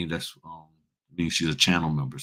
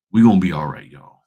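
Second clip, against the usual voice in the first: louder, punchy reassurance to the crowd; rallying close; 7 dB louder than usual. Real, not acted. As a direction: lift "We gonna be all right" like a sendoff. Closing rally to viewers.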